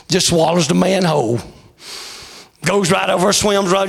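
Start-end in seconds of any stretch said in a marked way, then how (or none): none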